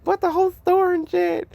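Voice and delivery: put-on high, thrilled kid voice; squealing about Disneyland loot; excited kid. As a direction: gush like a kid with a haul of toys. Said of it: "It's just like".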